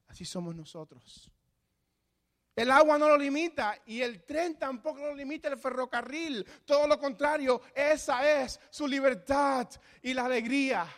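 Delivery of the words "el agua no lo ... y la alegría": louder, higher climactic preaching; preaching at full force. Acted. The pastor urges the church to believe, full fervour. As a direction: drive the point home with fervour.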